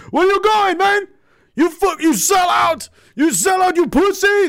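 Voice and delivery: high, loud jeering mob shouts; mob yelling. Retold or imitated. Angry mob chasing the NDP leader out of town, jeering shouts. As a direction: bellow insults at him like an angry crowd.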